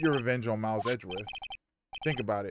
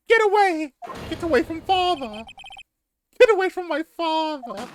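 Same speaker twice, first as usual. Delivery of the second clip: put-on very high, loud British-boy shriek; screaming child; 7 dB louder than usual. Young boy defending his father from an attacker, shrill scream. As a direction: yell in a squeaky, alarmed child voice.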